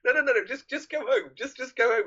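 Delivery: imitating a frantic friend saying go home; pleading friend. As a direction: shoo him off home, pitch up and pleading. Matthew urging friend home.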